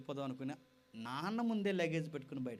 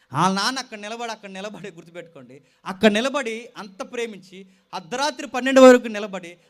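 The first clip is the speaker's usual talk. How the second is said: impassioned preaching; 5 semitones higher, 18 dB louder than usual. Real, not acted. Preacher stresses the father's love.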